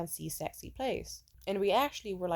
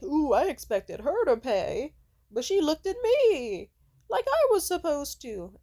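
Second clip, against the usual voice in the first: high, loud, drawn-out whine of indignation; slow, exaggerated imitation of a complainer; mock complaining; 8 semitones higher, 8 dB louder, slower than usual. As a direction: whine it like a wronged cheapskate, hammed up. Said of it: "he complained about".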